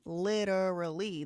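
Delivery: slow demo of the flap in LITERALLY, "lidderally"; flapped T. Shown as a demonstration. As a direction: stretch LITERALLY and tap the T like a D. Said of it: "it's called flap when you reduce".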